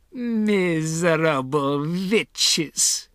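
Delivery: put-on high, very drawn-out accented insult; scornful witch yell. A narrator's reading. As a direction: stretch the contempt across each word. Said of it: "she yelled".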